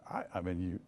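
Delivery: normal talking voice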